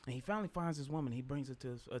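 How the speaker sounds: normal talking voice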